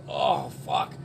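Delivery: low whispered oh fuck; mock whispered curse; whispered dismay. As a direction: breathe it out in despair. Seeing the long line.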